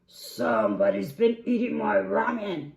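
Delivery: booming, outraged big bear; Papa Bear. Acted. Papa Bear at his ramen bowl, outraged roar. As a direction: roar it very loudly.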